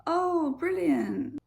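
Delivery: high falling "Oh, brilliant" with a dry sarcastic edge; fake-pleased sarcasm. Shown as a demonstration. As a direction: say "brilliant" like it's awful. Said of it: "It depends on the tone".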